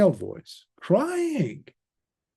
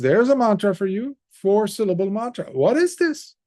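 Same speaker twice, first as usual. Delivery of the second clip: higher and louder, pressing; anxious king; 7 semitones higher, 4 dB louder than usual. Acted. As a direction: push for an answer in fear. King demands explanation.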